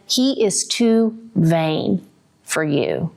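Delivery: slow, deliberate mother's warning; mother weighing every word. Retold or imitated. Mother to daughter about her fiancé, slow and deliberate. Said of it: "she said".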